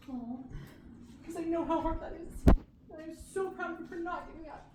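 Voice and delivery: emotional, on the edge of crying; tearful, voice breaking. Real, not acted. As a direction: push through the tears to praise her. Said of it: "I'm gonna cry".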